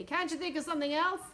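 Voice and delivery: high, loud, exasperated question; cross. A narrator's reading. President wanting another idea from the general, cross. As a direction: demand it crossly. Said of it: "said the president crossly".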